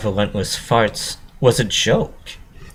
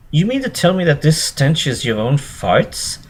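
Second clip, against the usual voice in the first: disbelieving; 9 semitones higher than usual. A narrator's reading. Disbelieving pony voice.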